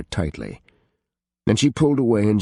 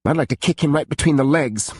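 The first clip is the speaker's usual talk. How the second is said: usual speaking voice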